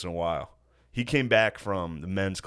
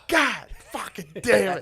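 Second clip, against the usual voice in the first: cursing dad; 10 semitones higher than usual. Retold or imitated. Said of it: "you just hear this".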